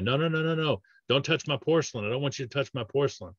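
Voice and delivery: quick, high-pitched imitation of a patient objecting; protesting patient. Acts out patient refusing bite adjustment.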